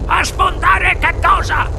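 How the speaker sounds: put-on high, startled old grandfather; startled, strained high query; alarmed question. A narrator's reading. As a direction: demand an answer in high-pitched alarm.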